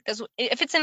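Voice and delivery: usual speaking voice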